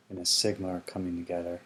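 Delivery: ordinary delivery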